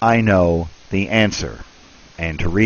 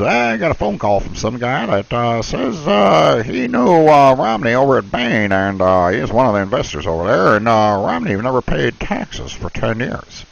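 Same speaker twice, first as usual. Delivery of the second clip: imitating Harry Reid; 4 semitones higher than usual. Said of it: "Harry Reid comes out and says".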